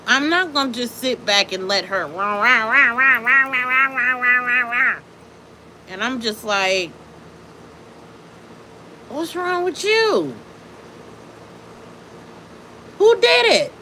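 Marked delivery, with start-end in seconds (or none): 2.1-5.0 loud, run-on babble of rah-rah; droning mock tirade; mocking rant noise. Mocking her endless ranting. 9.1-13.7 high-pitched mock concern; high, overdone demand for answers; exaggerated questioning. Acting out confronting the woman.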